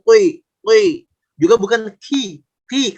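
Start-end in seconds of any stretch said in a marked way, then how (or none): 0.0-1.0 "qi" rounded into "qui"; overly thick qi. Shown as a demonstration. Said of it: "bukan qi". 2.0-3.0 "ki" with a light, front k; thin kaf. Shown as a demonstration. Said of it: "kalau ini kaf".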